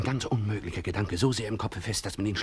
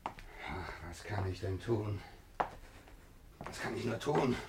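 quiet despair; 12 dB quieter, slower than usual. Acted. Alexei despairing softly.

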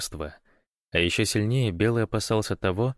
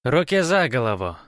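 loud, higher, clearly spoken order; distinct command; 5 semitones higher, 5 dB louder than usual. A narrator's reading. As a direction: order it distinctly, as if holding someone at gunpoint. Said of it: "отчётливо произнёс незнакомый голос". (отчётливый громкий приказ)

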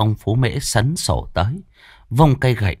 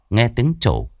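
ordinary delivery, nothing marked

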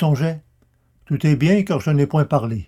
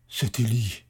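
shuddering dread; 4 semitones lower, 8 dB quieter than usual. A narrator's reading. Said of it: "avec un grand frisson, dit".

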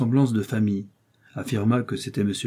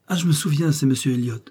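raised, higher start that settles down; sudden recognition; 6 semitones higher than usual. A narrator's reading. Marie recognizes the man outside, mildly excited. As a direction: exclaim as the memory comes back.

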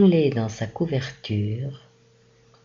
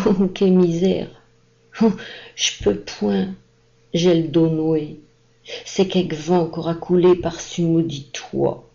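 put-on groaning old man; 6 semitones higher, 4 dB louder than usual. A narrator's reading. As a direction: wail about the aching back, louder and higher. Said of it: "se mit à gémir".

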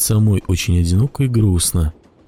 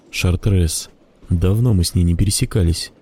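plain reading voice

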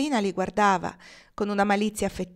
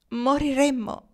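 higher, slow, drawn-out tragic cry; tragic exclamation; 6 semitones higher, slower than usual. A narrator's reading. Young blonde to her sweetheart about being found out, tragic exclamation. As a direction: declare it with theatrical tragedy. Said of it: "esclamò tragicamente la biondina".

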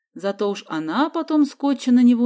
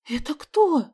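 scared; 8 semitones higher, slower than usual. A narrator's reading. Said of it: "испуганно спросила Соня".

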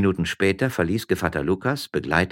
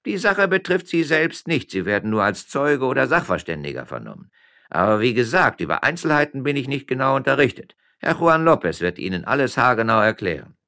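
lightly voiced policeman, higher and louder; hint of a loud, high-pitched official; officious policeman; 8 semitones higher, 6 dB louder than usual. Policeman explains the summons to the miller, officiously.

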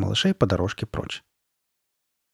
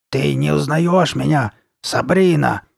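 slow, higher raspy monster voice; Карли Бет; 5 semitones higher, 4 dB louder, slower than usual. A narrator's reading. Repeating the question to her friend, hoarse rasp. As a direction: rasp the question, stretched and insistent. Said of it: "Карли Бет повторила свой вопрос".

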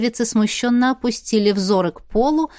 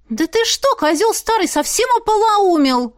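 put-on high, indignant girl's outburst; 11 semitones higher than usual. A narrator's reading. Village girl rebuking the old tsar, outraged. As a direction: lash out in a much higher voice.

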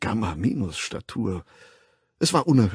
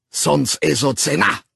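higher, louder cutting curse; cutting fierceness; 6 semitones higher, 6 dB louder than usual. A narrator's reading. The Savage cursing through the door, higher and louder. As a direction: slash the words out. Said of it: "mit welch schneidender Wildheit".

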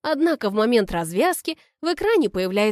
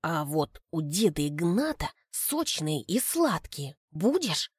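softer, lower conspiratorial voice; 4 semitones lower, 5 dB quieter than usual. Acted. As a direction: share the secret quietly. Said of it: "понижает голос".

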